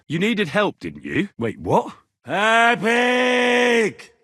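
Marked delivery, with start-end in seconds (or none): 2.2-4.1 loud, high call across a distance; yelling a name into the open. Acted. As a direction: shout the name to find him. Calling out for the horse.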